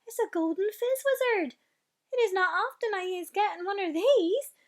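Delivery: high, loud delighted giant voice; gleeful, loud exclamation; excited cry. Acted. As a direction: cry out with joy over the catch. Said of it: "he cried".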